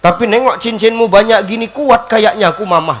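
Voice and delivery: loud hammed-up husband's wail; exaggerated loud grieving; mock crying harder. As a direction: bawl theatrically for a lost wife. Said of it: "makin kuat nangisnya".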